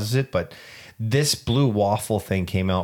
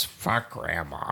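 mock old man; 5 semitones lower, 8 dB quieter, slower than usual. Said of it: "It was like".

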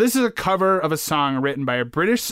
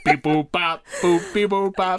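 mock robot bleeps, one by one; robot impression; 4 semitones higher, slower than usual. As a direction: beep and boop like a toy robot. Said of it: "did an impression of a robot".

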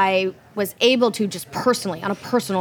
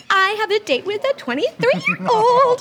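high excited imitation of a friend; imitating Jesse; 12 semitones higher than usual. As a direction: squeal it like a giddy friend. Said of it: "you come up to me and you're like".

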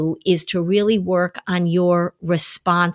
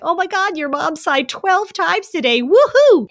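much higher, building to a loud gleeful woohoo; mock-thrilled wife reporting to her husband; giddy, high-pitched celebration rising to a whoop; excited cheer; 10 semitones higher, 4 dB louder than usual. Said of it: "she'd be like".

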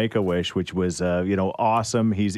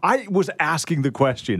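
laughing; 9 semitones higher than usual. Real, not acted. Laughing while defending.

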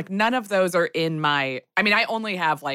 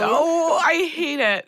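dread; 10 semitones higher, slower than usual. Real, not acted. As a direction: recoil at the title, voice jumping up. Reacts to scary movie title.

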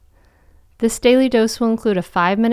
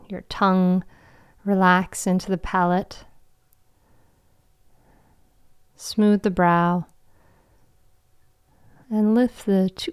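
guided meditation. Acted. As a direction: guide them gently into calm.

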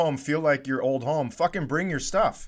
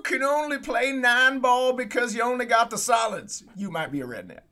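higher put-on comic voice dropping on the punchline; 7 semitones higher than usual. Parody of a redneck joke routine.

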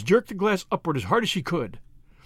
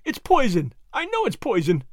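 pleading moan, raised in pitch; 5 semitones higher than usual. A narrator's reading. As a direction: moan it out as if begging for your life. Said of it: "she moaned".

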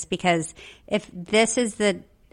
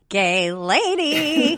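drawn out; 6 semitones higher, much slower than usual. Acted. Savoring the term slowly.

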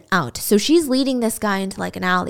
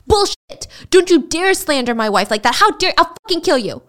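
furious threat; 6 semitones higher than usual. Acted. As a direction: snap and threaten like a jealous husband. Husband threatens client.

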